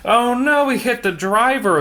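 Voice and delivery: high, loud gasp of surprise; a sudden startled oh no; surprised cry. Real, not acted. Reader reacts to the picture with a startled cry. Man hits the bike driver.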